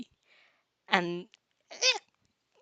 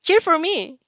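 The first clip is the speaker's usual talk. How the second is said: bright chirp, higher; a light, higher chirp; 8 semitones higher, 5 dB louder than usual. Acted. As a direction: chirp it up playfully. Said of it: "you chirp".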